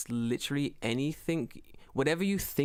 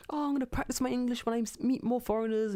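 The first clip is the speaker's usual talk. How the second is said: put-on old woman; 8 semitones higher than usual. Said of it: "Old woman's like".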